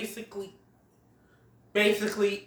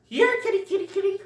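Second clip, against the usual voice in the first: much higher sing-song kitty call; teasing possums; 13 semitones higher, 3 dB louder, faster than usual. Retold or imitated. Possums taunting Diego, high sing-song call. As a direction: taunt the cat in a high coo. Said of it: "some possums go".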